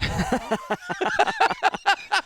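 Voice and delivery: high burst of real laughter; big laugh. Real, not acted. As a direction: laugh hard at the punchline. Laughing at own story.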